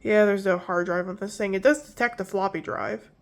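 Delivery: ordinary talk, nothing marked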